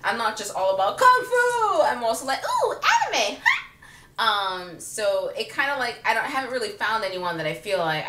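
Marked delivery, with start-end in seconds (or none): 1.0-1.8 mock martial-arts yell, high and loud; hammed-up kung fu cry. Exaggerated shout of her hobby. 2.4-3.6 very high mock-excited coo; hammed-up fangirl squeal. Acts out gushing over anime.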